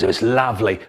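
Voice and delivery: ordinary delivery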